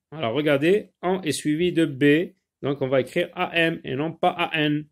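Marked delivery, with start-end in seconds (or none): none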